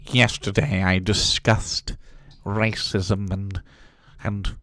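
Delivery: slow, ponderous put-on voice; over-deliberate intellectual delivery, hammed up; affected speaker parody. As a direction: draw each phrase out with mock importance. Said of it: "That's like me saying".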